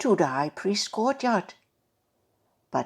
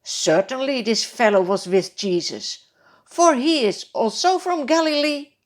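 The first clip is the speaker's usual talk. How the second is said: louder, rising assured claim; a bystander sure of himself; confident assertion; 5 semitones higher, 6 dB louder than usual. Acted. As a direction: point him out to the crowd. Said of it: "Another asserts".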